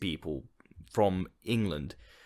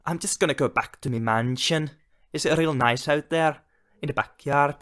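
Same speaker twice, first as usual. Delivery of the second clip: showy rich-man boast in a mock northern English accent, pitched higher and louder; 6 semitones higher, 5 dB louder than usual. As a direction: brag about the mansion in a put-on northern English accent. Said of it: "I've never heard a Nordic person try and, try and do".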